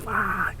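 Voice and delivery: a tiny high breathy ah, barely voiced; whispered gasp. Grandmother waves off the grandson, small whispered ah. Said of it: "Just, just like".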